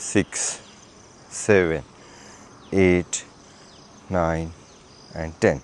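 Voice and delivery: low, spaced-out rep count; exercise counting. Acted. Instructor counting hip rotations, steady low count. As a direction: count each rotation evenly, dropping the pitch.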